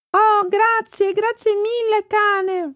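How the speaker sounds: put-on very high, drawn-out child's thanks; grateful little girl. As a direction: thank your rescuer as a relieved child. Bambina salvata ringrazia il cane. (bambina grata, voce molto acuta)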